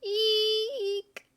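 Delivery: sharp high-pitched eek; high squeal. Acted. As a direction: squeak in mock fright. Mock fright squeal.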